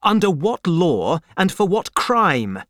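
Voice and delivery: high, protesting question; indignant cry. A narrator's reading. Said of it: "cried Darnay".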